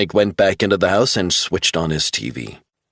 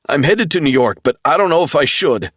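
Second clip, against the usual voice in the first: much higher, tense and rushing near the end; panicked, voice tight; frightened young man; 8 semitones higher, 4 dB louder than usual. A narrator's reading. Travell frightened.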